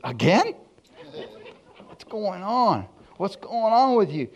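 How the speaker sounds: high, incredulous wife imitation; exasperated wife. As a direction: act out a baffled wife, voice swooping up. Wife's exasperated reaction retold.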